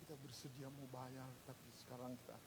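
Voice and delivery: very soft, low, halting plea; hushed, deferential apology with pauses; acted humble apology. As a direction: play a debtor timidly asking for time. Acts debtor humbly begging for time.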